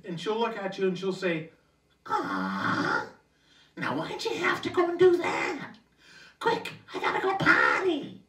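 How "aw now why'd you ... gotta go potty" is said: much higher, louder, raspy cartoon mom voice; Marge Simpson. Marge Simpson. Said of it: "talking like Marge Simpson".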